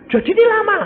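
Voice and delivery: loud, high incredulous question; dismayed question. Acted. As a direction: cry it out in disbelief. Acted question, then answer. (pertanyaan kaget bernada tinggi)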